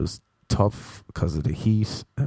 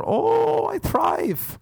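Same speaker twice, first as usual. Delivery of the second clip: excited shout; much slower than usual. Real, not acted. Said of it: "put me in structure".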